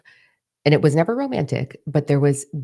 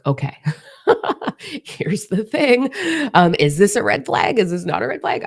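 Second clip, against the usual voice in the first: high, playful oversharing with a laugh; mock therapy confession; 7 semitones higher, 3 dB louder than usual. Asking followers as therapists about red flags, mock therapy confession. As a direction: pitch it up, drawing out the opener, then louder questions. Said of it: "you guys can all be my therapy. I'll be like".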